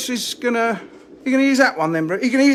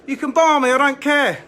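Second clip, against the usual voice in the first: mock defiant; 5 semitones higher, 6 dB louder than usual. Real, not acted. Defiant jab at haters.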